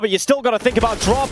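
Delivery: ordinary delivery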